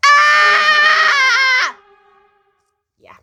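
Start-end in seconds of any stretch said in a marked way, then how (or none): 0.1-1.8 high, loud mock scream; inner scream. Screaming inside while keeping a straight face, high mock scream. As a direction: let out a cartoon shriek. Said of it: "in my head, I'm like".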